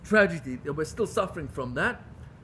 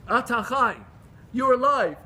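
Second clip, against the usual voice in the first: louder, higher, declaring; rising, forceful divine rebuke; God's voice; 4 semitones higher, 6 dB louder than usual. Acted. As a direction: proclaim it as God speaking. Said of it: "Says the Yalkut".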